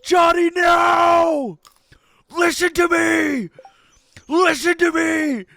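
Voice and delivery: high, very loud desperate yelling, slow and pleading; a fan screaming at the screen to stop the mistake; shaking with panic, begging at full volume; frantic panicked shouting. Retold or imitated. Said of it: "watching his screen, like, shaking, going".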